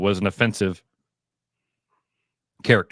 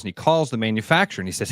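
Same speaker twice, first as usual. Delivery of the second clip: ordinary talk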